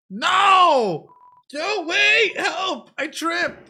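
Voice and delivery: high, loud squeaky cry for help; a little monster yelping as it trips; frantic kid monster. Acted. Monster Kid calling to the player, high and frantic.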